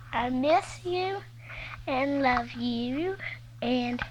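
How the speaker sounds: slow, soft and wistful; tender. Real, not acted. Little girl telling her faraway daddy she misses him. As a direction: say it quietly, missing him.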